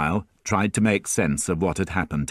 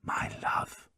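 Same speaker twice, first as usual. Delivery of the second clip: soft, breathy whisper of love; 12 dB quieter than usual. A narrator's reading. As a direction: breathe it to her. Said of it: "Marius whispered".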